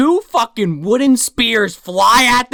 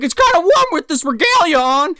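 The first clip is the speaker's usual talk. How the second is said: high complaining Santa voice; mock Santa; 9 semitones higher, 3 dB louder than usual. Santa hot in his regalia, high mock complaint. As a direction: grumble in a raised, hammed-up voice. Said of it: "He's like".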